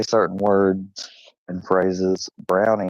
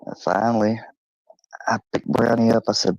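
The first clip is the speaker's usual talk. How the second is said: ordinary talk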